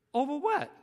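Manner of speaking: high-pitched baffled question; retold incredulous query; mock puzzlement. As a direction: act taken aback by the complaint. Retold puzzled reply to caller.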